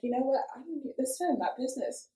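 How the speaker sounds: quietly backing off. Retold or imitated. Retold backing off from asking.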